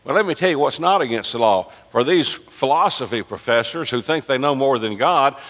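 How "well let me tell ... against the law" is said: ordinary delivery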